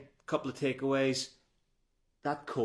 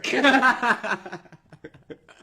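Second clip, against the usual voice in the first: loud high cackling laugh, tailing off; high-pitched belly laugh; big laugh; 12 semitones higher, 11 dB louder than usual. Real, not acted. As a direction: burst out laughing at your own joke. Laughs at own joke.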